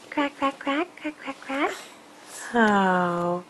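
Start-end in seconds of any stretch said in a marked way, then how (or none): 0.1-1.7 soft duck quacks. Pretend.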